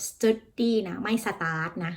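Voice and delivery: study set slowly against start; slow contrast. Shown as a demonstration. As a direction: stretch out study versus start so they hear the difference. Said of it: "คนไทยจะชินกับว่า study study". (พูดช้าๆ เทียบ study กับ start)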